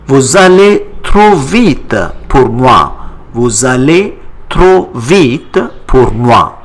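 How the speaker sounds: Vous allez trop vite pour moi with the z liaison; clear, paced French for learners; model answer. Shown as a demonstration. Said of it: "give your answer".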